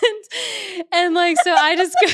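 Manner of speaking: high-pitched laughing fit; helpless laughter. Real, not acted. Laughing at herself with friends, high-pitched laughing fit. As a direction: laugh until it cracks. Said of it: "helpless laughter".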